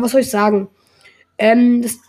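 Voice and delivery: ordinary talk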